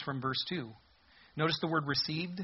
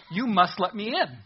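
entitled demand; 6 semitones higher, 8 dB louder than usual. Acted. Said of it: "the attitude must be".

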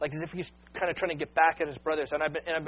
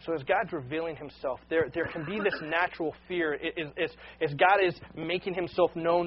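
ordinary talk, nothing marked

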